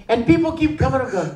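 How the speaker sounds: normal talking voice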